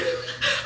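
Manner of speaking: short, breathy high giggle; laughing. Real, not acted. About to tell the funny part, giggling. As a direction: let a quick giggle escape.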